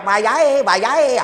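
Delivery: hammed-up call to bedtime; mock call. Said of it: "aku ya ngono".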